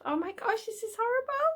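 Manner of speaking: high, soft, rising dismay. Real, not acted. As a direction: squeak it quietly, pained. Dismayed at sad fan theory.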